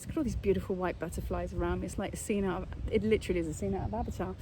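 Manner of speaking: soft, fast, awed hush; hushed awe. Real, not acted. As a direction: murmur in wonder.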